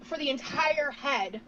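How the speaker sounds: words pushed out on a slow burp. Real, not acted. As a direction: talk through a long burp. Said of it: "burping like a creaky chair".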